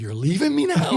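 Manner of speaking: high, loud, laughing disbelief; playing his wife half-laughing at him; laughing, incredulous wife; wife's mock outrage. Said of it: "and she said".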